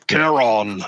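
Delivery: Caron boomed like a Norse god. Shown as a demonstration. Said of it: "you gotta say it like a, like a Norse god".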